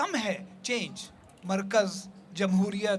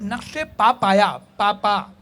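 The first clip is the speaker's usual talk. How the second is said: नक्शे पा पाया slowed, both पा sounds hit loudly; पा पा picked out loud as two equal beats; stress on doubled पा; 7 dB louder, slower than usual. Shown as a demonstration. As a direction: punch पा पा to expose the clashing repeat. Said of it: "ये गलत है".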